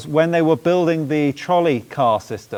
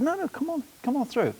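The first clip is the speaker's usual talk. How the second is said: put-on light Oklahoman accent, pitched higher for an old gentleman; 8 semitones higher, 6 dB quieter than usual. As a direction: mimic a courteous Oklahoman gent holding the door. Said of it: "in an Oklahoman accent".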